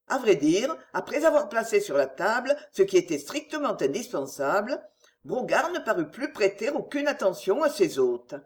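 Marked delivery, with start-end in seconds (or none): none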